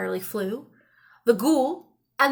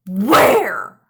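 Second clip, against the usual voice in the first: louder, sharp question; eager demand; 4 semitones lower, 6 dB louder than usual. A narrator's reading. Old man demands the pie from the wizard, loud. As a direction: snap it out hungrily.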